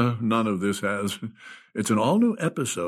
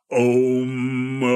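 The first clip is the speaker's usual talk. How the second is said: a lightly chanted om; mock Buddha om. Writer imitating a meditating Buddha, light mock chant. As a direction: hum it like a laughing Buddha, half joking. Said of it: "sitting cross-legged on the carpet going".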